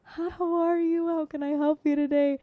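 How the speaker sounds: high-pitched, sugary customer-service hello; nicer greeting. Retold or imitated. Shop lady retrying her greeting for grandma, extra nice and high. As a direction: put on an eager-to-please, high greeting.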